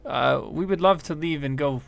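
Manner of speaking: a worried little scientist begging; gnome woman pleading. Acted. Gnome woman explains the problem.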